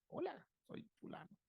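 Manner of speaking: very high, thin toy voice out of the dark; talking toy voice. Retold or imitated. A child's toy greeting him in the night, high and eerie. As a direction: squeak it like a doll coming alive.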